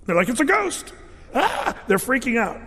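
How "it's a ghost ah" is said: high, panicky ghost scream, lightly played; hammed-up fright at a ghost; mock terrified cry. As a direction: disciples shrieking at a ghost on the water, mock panic. Disciples terrified, see Jesus on sea.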